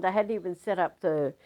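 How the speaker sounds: normal talking voice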